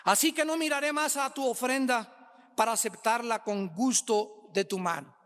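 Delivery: ordinary talk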